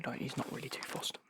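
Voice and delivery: normal talking voice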